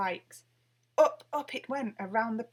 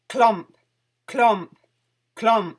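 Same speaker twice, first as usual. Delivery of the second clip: very loud heavy clomps; stomping footsteps; 15 dB louder, much slower than usual. A narrator's reading. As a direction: boom out each footstep. Giant postman's boots approaching.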